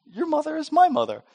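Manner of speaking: high, quick mock protest; indignant correction. Sibling pointing out they share parents, high mock-indignant voice. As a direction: pitch it up in mock disbelief.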